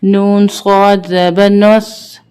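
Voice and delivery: slow, measured chant with long held vowels; Qur'anic spelling recitation. Shown as a demonstration. Teacher spelling verses for learners, measured chant. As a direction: intone each letter, then blend it.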